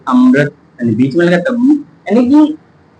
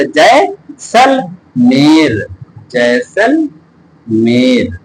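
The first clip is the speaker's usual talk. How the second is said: slow sounding out; much slower than usual. Shown as a demonstration. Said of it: "teacher reads new word slowly".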